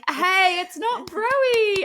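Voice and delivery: high, drawn-out "Hey, it's not Broy"; exaggerated voice for the pub's name; mock exclamation. Telling a friend about the pub, high playful mock voice. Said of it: "But I was like".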